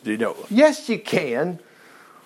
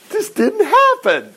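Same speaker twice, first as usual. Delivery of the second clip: much higher, slow mocking voice; mock skeptic; 13 semitones higher, slower than usual. Preacher mocking those who say it never happened, high and slow. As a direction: mimic a scoffer denying it.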